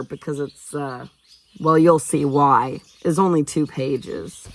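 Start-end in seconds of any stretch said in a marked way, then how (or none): none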